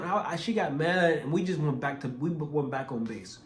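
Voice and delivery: ordinary talk, nothing marked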